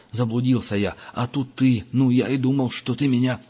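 put-on a scared boy babbling his excuse; frightened rush. A narrator's reading. Boy pinned on the bridge pleading with the hunter, rising panicky babble. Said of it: "затараторил Франц".

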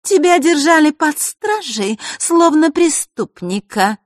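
loud, high-pitched scornful exclamation; contemptuous. A narrator's reading. Marishka belittles Vasilisa before her friends, loudly contemptuous. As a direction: sneer it high and loud for all to hear. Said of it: "презрительно произнесла хрустальная ключница".

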